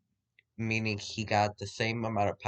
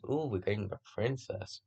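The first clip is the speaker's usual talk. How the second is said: soft, offhand remark; nonchalant; 9 dB quieter than usual. Acted. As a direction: toss it out quietly, mildly nonchalant. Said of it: "Nonchalantly says".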